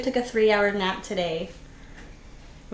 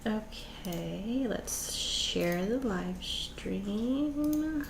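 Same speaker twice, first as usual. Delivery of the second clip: whispering; 13 dB quieter, much slower than usual. Real, not acted. Quietly setting up the stream.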